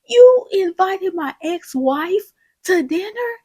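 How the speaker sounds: high, loud, slow incredulous question; shocked disbelief. Retold or imitated. Husband learns his ex-wife is invited, shocked. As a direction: draw out the outraged disbelief.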